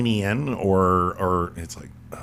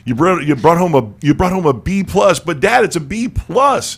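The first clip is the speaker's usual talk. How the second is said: put-on pleading kid; 7 semitones higher, 6 dB louder than usual. Child pleading with Indian parent.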